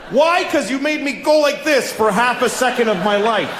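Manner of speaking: usual speaking voice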